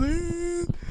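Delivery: high, loud comic wail; mock crying. Telling friend how they ran back scared, mock crying wail. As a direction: imitate bawling, rising into a high whine. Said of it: "I was crying".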